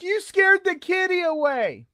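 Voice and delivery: put-on very high child voice complaining; little girl complaint. Scared girls complaining to the haunter. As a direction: accuse like a hurt little girl. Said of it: "they're like".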